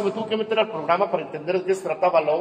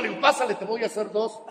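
ordinary talk, nothing marked

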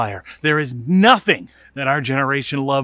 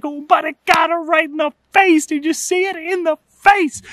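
high, loud giddy shout; gleeful kid shout; 12 semitones higher, 4 dB louder than usual. Retold or imitated. Boys insisting on the kick in a high giddy shout. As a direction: crow about it like a delighted brat. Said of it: "We're like".

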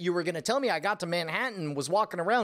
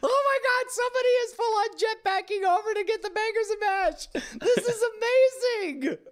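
high-pitched excited outcry; real amazement; 3 dB louder than usual. Real, not acted. Co-host seeing someone jetpack between boats, amazed and excited. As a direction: exclaim in delighted disbelief, voice way up.

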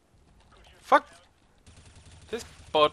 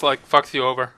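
ordinary talk, nothing marked

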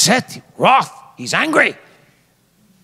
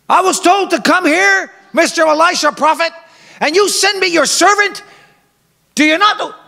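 indignant Naaman; 11 semitones higher than usual. Acted. Preacher voicing Naaman, offended he was snubbed, high and indignant. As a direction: play the proud general who feels slighted.

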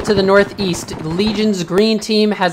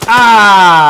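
pained cry; 3 semitones higher, 8 dB louder than usual. Acted. Player hit in combat.

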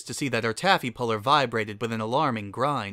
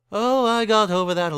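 raised, louder aristocrat character voice; Caesar; 10 semitones higher, 7 dB louder than usual. A narrator's reading. Noble pony waves off the commoner question, raised character voice. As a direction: brush it off, high and loud.

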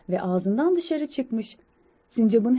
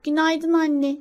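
put-on higher-pitched child squirrel voice; young squirrel; 6 semitones higher, slower than usual. Young squirrel greeting mother in the morning, high childlike voice. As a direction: voice a little one greeting a parent. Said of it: "Çalışkan sincap".

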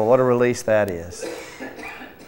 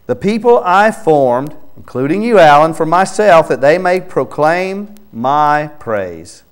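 raised, louder reading of the verse; fervent scripture reading; 6 semitones higher, 6 dB louder than usual. Acted. Preacher reading Isaiah aloud to Alan, fervent and louder. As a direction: proclaim the verse over the listener.

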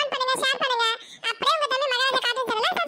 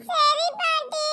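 tearful yes; slower than usual. Acted. Child agrees through tears.

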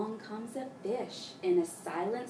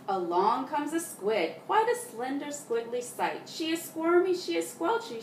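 lively reading; 7 semitones higher, 5 dB louder than usual. A narrator's reading.

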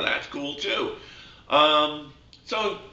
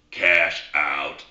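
much lower, deadpan frog voice; a low, slow little frog calling out the club; talking frog; 10 semitones lower than usual. Acted. Golfer hears a frog suggest a club, much lower frog voice. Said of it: "frog goes".

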